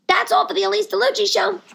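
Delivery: high-pitched announcer flourish; showy sign-off. Real, not acted. Host closing her show, high showy announcement.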